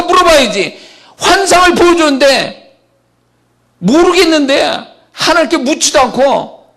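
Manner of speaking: high, loud, impassioned sermon cry; fervent preaching. Acted. Pastor urging the congregation to ask God, in a high, loud preaching cry. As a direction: let it ring out like a revival preacher.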